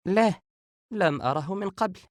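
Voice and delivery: high-pitched woman's voice, absorbed. A narrator's reading. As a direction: voice a distracted sister up high. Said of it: "وهي سارحة في الصورة".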